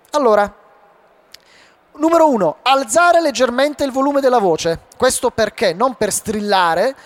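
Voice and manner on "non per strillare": usual speaking voice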